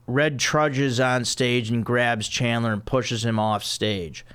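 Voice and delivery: ordinary talk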